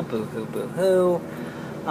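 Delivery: slow, soft mock sobbing; drawn-out sarcastic boo hoo; fake crying. Mocking own sad complaints.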